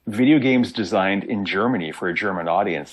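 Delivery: normal talking voice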